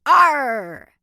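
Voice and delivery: loud pirate growl; loud pirate arr. Acted. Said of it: "Use your best pirate voice".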